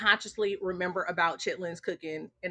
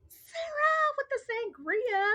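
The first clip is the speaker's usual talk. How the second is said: sing-song tease. Acted. Teasing chat reader over sangria.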